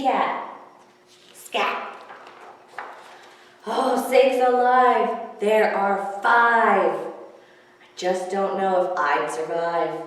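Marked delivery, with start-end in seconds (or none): 3.7-6.9 drawn-out, louder exasperation; loud slow dismay. Acted. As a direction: exclaim slowly, aghast. Dismayed at more kitties.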